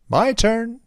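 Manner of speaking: high, loud eager call; eager tailor. Acted. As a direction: jump in with a loud claim. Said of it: "said the tailor".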